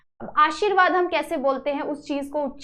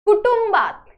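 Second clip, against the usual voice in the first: कुटुंबात said higher and louder, stressed; emphatic demo; 6 semitones higher, 5 dB louder than usual. Shown as a demonstration. Teacher introducing the word to students, higher and louder. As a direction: announce कुटुंबात brightly to the class. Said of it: "कुटुंब कैसे लिखेंगे हम?".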